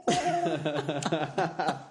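laughing while apologizing; breaking into laughter. Real, not acted. Embarrassed laughing at own joke.

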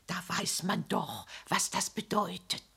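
low, soft gossiping murmur; insinuating whisper. Acted. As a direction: lean in and hint at the scandal.

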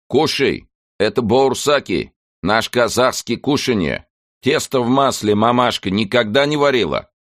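put-on high loud shout with a Kazakh accent; shouting over machinery. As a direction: yell it over the train noise. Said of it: "прокричал старый милиционер".

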